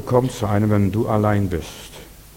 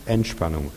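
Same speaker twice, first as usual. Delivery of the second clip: ENTSPANNUNG dropped low and falling; relaxation; 5 semitones lower than usual. Shown as a demonstration. Preacher shows congregation release after tension, ENTSPANNUNG low and relaxed. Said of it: "Merkt ihr das?".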